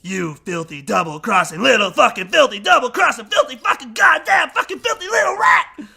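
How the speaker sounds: loud, building shouted tirade; raging Nick Cage insult. Retold or imitated. Host reciting the villain's line to Uncle Lou, shouting. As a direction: spit out the insults in a rising rage. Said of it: "here's the line that he says".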